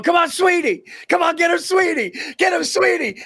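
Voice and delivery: hammed-up yell at the racetrack; cheering shout. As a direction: urge the horse on, shouting. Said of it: "He's going".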